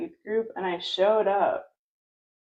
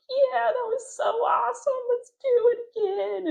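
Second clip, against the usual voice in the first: put-on much higher, gushing kids' cheer; imitating delighted kids begging for more; excited kids. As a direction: squeal it like thrilled kids. Kids cheering after being thrown off.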